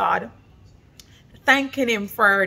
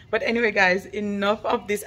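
usual speaking voice

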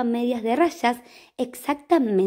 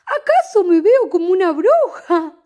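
amused sweeper; 6 semitones higher, 8 dB louder than usual. Acted. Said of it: "se reía".

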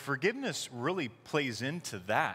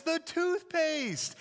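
put-on high, slow whiny kid voice; whiny child; 14 semitones higher, much slower than usual. Son explains to dad, high slow whining child voice. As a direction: draw it out like a miserable little boy. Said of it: "And he says".

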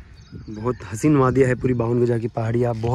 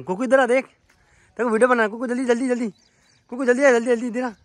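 calling out; 11 semitones higher, 5 dB louder than usual. Real, not acted. Uncle calling his niece over for the video, high and loud. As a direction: call her over in a hurry.

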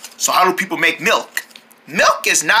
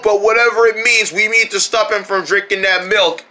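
normal talking voice

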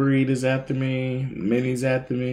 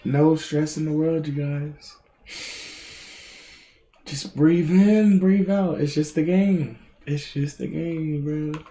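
higher, drawn-out soothing voice; self-soothing calm; 4 semitones higher, slower than usual. Real, not acted. Streamer reminding himself it's just a game, soothing and drawn out. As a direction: breathe it out slowly, easing down.

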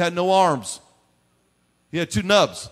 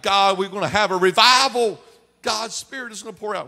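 higher-pitched revival cry; excited proclamation; 7 semitones higher, 4 dB louder than usual. Acted. Believer announcing God's outpouring to church, excited proclamation. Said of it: "We're saying we're gonna have revival like".

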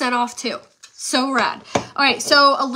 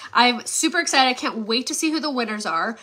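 ordinary delivery

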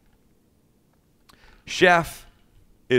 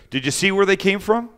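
higher, louder demanding question; angry question; 8 semitones higher, 5 dB louder than usual. Retold or imitated. Chef grilling the young cook over the peaches, rising anger. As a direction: press the cook hard for an answer. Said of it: "he goes".

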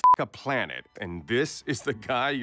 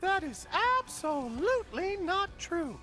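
very high, gushing insistence; over-eager denial. Acted. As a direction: insist brightly in a very high pitch. Denies being dead, over-eager.

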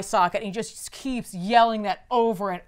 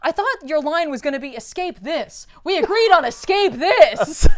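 higher, louder mock scolding; mock scolding wife; 5 semitones higher, 6 dB louder than usual. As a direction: tease him like a wife scolding. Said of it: "Barda's like".

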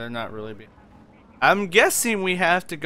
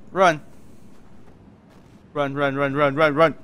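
being chased; 4 dB louder than usual. Real, not acted. Being chased, urging himself.

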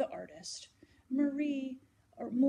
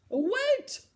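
loud call; 13 semitones higher, 10 dB louder than usual. Acted. Guard stops the listener.

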